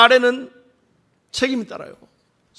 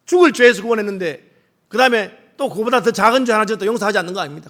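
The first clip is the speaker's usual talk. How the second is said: normal talking voice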